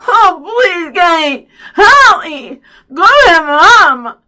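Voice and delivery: high, loud garbled pleading; mouth-full mumbled yell. A narrator's reading. Byron pleads while stuck fast, garbled desperate yelling. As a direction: plead through lips that won't move. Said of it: "sounding like he had something in his mouth".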